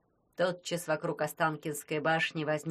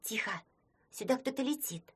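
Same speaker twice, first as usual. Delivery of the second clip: whispering; 5 semitones higher, 5 dB quieter than usual. Acted. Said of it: "шепнула Снегурочка".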